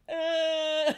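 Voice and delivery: put-on high, loud half-laughed baby wail; mock baby cry. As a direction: burst into a fake baby cry. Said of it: "She's like".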